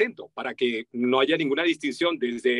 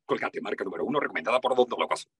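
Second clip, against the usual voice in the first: low, smooth ad read; commercial voiceover; 7 semitones lower than usual. Shown as a demonstration. Voice actor showing his famous ad, commercial read. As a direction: deliver a toothpaste tagline in a lowered voice. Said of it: "me conocen por decir".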